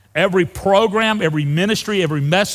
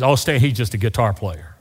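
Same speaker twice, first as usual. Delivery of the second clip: lower, offhand brush-off; mock dismissive; 6 semitones lower than usual. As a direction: wave him away lightly.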